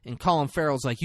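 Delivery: ordinary talk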